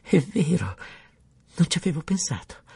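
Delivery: light up with sudden delight; joyful cry. A narrator's reading. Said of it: "mandò un gridolino di gioia".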